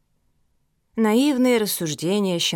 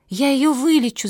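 high, urgent exclamation; raised childlike voice, moderately loud; crying out; 8 semitones higher, 4 dB louder than usual. A narrator's reading. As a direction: cry out with desperate resolve. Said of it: "закричала Мафи".

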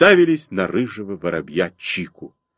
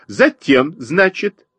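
high twittering sparrow; 7 semitones higher, slower than usual. A narrator's reading. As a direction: twitter it like a busy bird. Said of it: "продолжала щебетать воробьиха".

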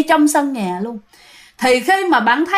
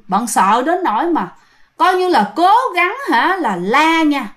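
ordinary delivery

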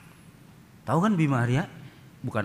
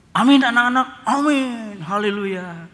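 high, loud cheerful blessing; cheery nun; 9 semitones higher, 9 dB louder than usual. Acted. Preacher playing the nun blessing the Sunday school children, high and loud.

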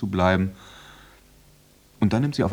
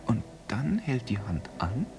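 suspenseful whisper; tense whisper; 6 dB quieter than usual. Acted. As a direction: hold your breath and whisper it. Tense moment watching the hand. (angespannt geflüstert)

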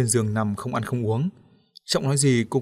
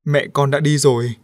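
trembling voice; 3 semitones higher, 6 dB louder than usual. A narrator's reading. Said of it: "Ông Thúc run giọng gạt Liễu ra".

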